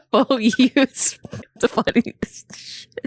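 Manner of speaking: high, slow, breathless with laughing; helpless laughter. Real, not acted. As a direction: squeeze the words out between laughs. Talking through a laughing fit.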